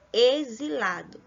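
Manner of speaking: ordinary talk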